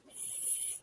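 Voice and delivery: the letter "S" as an isolated long hiss; tapped-out sound. Shown as a demonstration. Teacher taps out song, "S" hissed on its own. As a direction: sound "S" alone as an unvoiced sss. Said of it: "So tapping it out".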